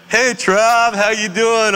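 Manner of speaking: a lightly voiced sunny barista; cheery [name] greeting. Old barista greeting a regular customer, bright and high.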